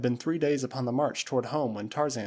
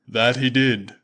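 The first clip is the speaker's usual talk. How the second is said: booming Waziri warrior; 8 dB louder, slower than usual. Acted. Usula answering his master Tarzan, loud and proud. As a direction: call it out like a loyal tribesman.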